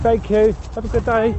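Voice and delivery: bright, loud thank-you; calling out. Real, not acted. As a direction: call thanks as you pass. Thanking walker while passing.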